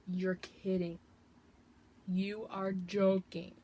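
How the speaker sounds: slow, drawn-out disbelief; amazed disbelief. Real, not acted. Vlogger stunned by the huge palette, slow amazed disbelief. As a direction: draw it out in awe.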